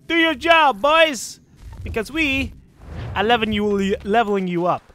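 high, loud rally of the troops; rallying call. Pretend. Commander sending his recruits out, loud rallying call. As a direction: cheer the boys on to do their job. Calling out orders to his crew.

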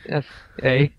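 Canadian "eh" tag; Canadian accent. Acted. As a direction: drop in a Canadian eh. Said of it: "See what I did there?".

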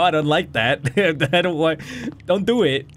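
normal talking voice

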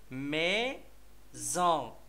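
slow "ميزون", mei, then a gap, zon; split into syllables. Shown as a demonstration. Teacher naming the third picture for children, "ميزون" slowly syllable by syllable. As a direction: hold each syllable of "ميزون" apart. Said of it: "السيلاب الثاني هو زون".

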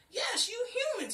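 tree mocks humans, dragging out the word; talking tree. A narrator's reading. Tree hits back at the girl, high, loud and slow. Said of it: "he replied".

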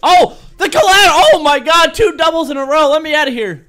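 high, loud rapid cheering; ecstatic yelling. Real, not acted. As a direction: scream with joy at the streak. Two doubles in a row.

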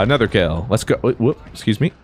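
normal talking voice

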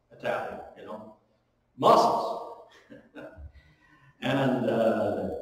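usual speaking voice

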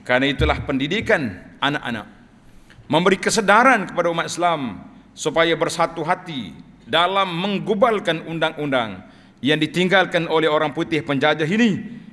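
ordinary talk

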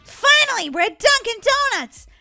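loud, high cheer on arrival; excited. Acted. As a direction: shout it with triumphant relief. Arriving at favorite place.

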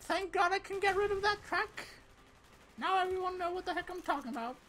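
high, bouncy put-on soldier voice; Mason mode. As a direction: play a cheerful, goofy Mason picking off enemies. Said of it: "Mason, activate mode".

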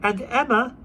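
put-on high little boy voice; small boy. A narrator's reading. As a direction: answer like a small child. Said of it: "said the boy".

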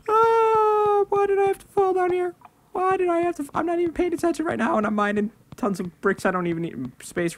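high squeaky whine, fading out; a real high-pitched lament; whiny falsetto. Real, not acted. Falling into the pit, whining.

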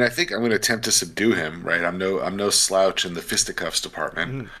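ordinary talk, nothing marked

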